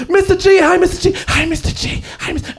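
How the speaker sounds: imitated child, high and repetitive; excited kid. Kid spots teacher in a supermarket, excited calling. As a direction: pitch it way up like an eager little kid. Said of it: "a kid actually saw me in a supermarket".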